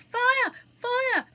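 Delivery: high, loud alarm cries; shriek. A narrator's reading. Theatre crowd seeing the flames, shrieking. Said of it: "and then people shrieked".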